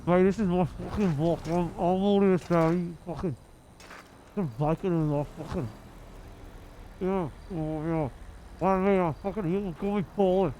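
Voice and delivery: put-on snarling Welsh accent, muffled; aggressive Welsh accent. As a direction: threaten him in a hard Welsh accent. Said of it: "aggressive Welsh".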